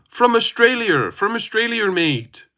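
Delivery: high, put-on Australian voice; Australian accent. Streamer telling chat where the accent is from, exaggerated Australian. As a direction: play up the Australian twang, pitched high. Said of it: "Where's your accent from?".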